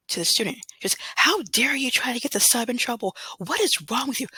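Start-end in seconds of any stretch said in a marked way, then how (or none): 1.2-4.3 high, sharp telling-off; scolding principal. Retold or imitated. Principal scolding the girl who blamed the sub, high and indignant. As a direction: scold her like an appalled principal.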